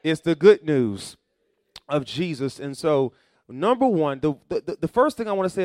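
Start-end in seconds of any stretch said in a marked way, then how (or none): 0.0-1.2 louder and slow, leaning on good; stretched emphasis. Shown as a demonstration. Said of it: "preached emphasis".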